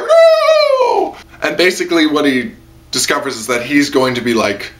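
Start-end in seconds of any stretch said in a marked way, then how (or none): 0.0-1.2 high, loud hammed-up protest; dramatic no. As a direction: wail the no in fake despair. Hero being taken away.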